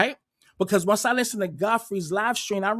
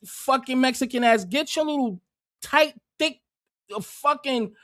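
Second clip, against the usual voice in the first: angry rant; 5 semitones higher than usual. Real, not acted. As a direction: tear into him, offended and furious. Reacting furiously to clip speaker.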